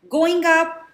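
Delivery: loud, high call to a crowd. Acted. Tour guide calls the crowd into the elevator, loud and high. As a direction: guide calling the group upward.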